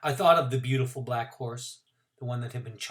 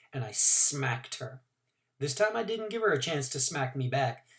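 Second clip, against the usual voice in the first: ordinary delivery, nothing marked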